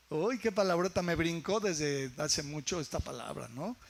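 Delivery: ordinary talk, nothing marked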